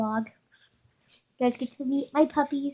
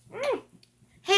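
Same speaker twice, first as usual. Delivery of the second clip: ordinary talk, nothing marked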